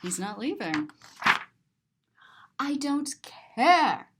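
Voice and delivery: usual speaking voice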